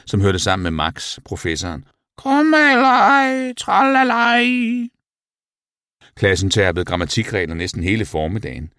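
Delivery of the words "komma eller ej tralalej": very high, loud, drawn-out sing-song; owl voice. A narrator's reading. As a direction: chant it like a hooting owl. Owl Muriel chimes in mockingly. (høj, syngende uglestemme)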